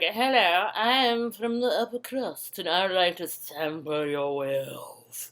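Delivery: put-on posh British gent; snotty upper-crust British. Posh customer propositioning a prostitute, snotty British accent. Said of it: "snotty, upper crusty British dude".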